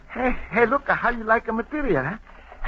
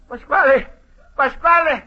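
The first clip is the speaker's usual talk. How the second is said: louder and higher, urgently calling a friend into the room; 8 semitones higher, 4 dB louder than usual. Acted. Luigi yelling for Pasquale to come quickly, Italian accent. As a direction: holler for help across the shop.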